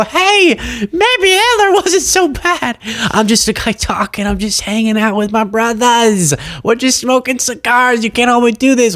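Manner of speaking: high-pitched whiny caricature of a podcaster; hammed-up protest, pitch soaring and words stretched; mock excuse-making. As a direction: imitate a defensive guy pleading innocence. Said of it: "when they get in trouble, go".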